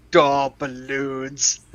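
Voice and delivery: slow dopey Boston drawl; Boston accent. Retold or imitated. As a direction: drag it out, thick Boston. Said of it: "a thick Boston accent going like".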